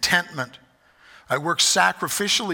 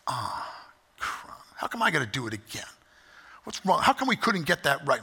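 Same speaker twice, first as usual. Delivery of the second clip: grumbling; 4 dB quieter than usual. Retold or imitated. Pastor acts out his own grumbling.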